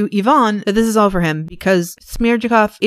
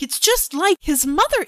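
put-on tipsy old father; 10 semitones higher than usual. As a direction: wail it like a maudlin old father. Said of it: "He's like".

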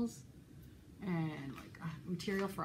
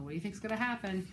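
ordinary delivery, nothing marked

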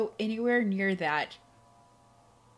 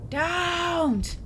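high, louder cry of dread; frightened plea; 8 semitones higher, 4 dB louder than usual. Real, not acted. Player begging the creature, frightened cry. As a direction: plead with the thing not to come.

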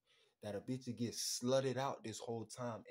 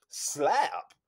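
much louder, a drawn-out cry; shouted slap; 15 dB louder than usual. Acted. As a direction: belt out the word slap. Comic loud word.

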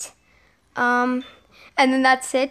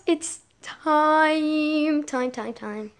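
fading excitement; 3 semitones higher, much slower than usual. Real, not acted.

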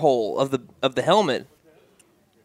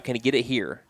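normal talking voice

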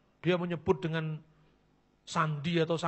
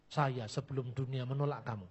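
usual speaking voice

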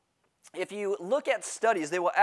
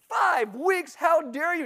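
indignant; 12 semitones higher, 5 dB louder, slower than usual. Retold or imitated.

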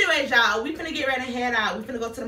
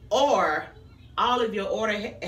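ordinary talk, nothing marked

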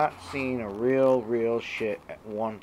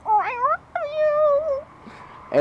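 very high squeaky cat voice saying I love you; 6 dB louder, much faster than usual. Retold or imitated. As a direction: mimic the cat's meowing I love you. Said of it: "you'll hear".

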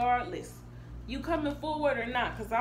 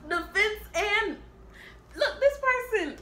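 much higher and louder, laughing in disbelief; shocked outburst; 9 semitones higher, 5 dB louder than usual. Real, not acted. High, laughing shock as the reader pulls Five of Wands. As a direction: yelp at the card in disbelief.

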